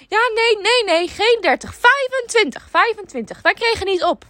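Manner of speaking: high, loud insistent customer; old lady voice. Retold or imitated. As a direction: correct it like a fussy old lady. Caller lady voiced in story.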